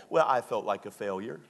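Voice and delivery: ordinary talk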